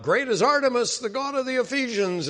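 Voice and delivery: mob crying out. Retold or imitated. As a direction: cry it out like a riled-up mob. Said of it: "the mob in Ephesus that was crying out".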